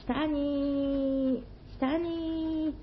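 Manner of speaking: high, drawn-out herald's cry; procession herald call. Acted.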